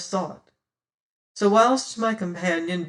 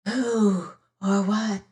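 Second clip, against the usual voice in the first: hissed softly; 3 semitones higher, slower than usual. A narrator's reading. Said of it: "hissed a soft voice".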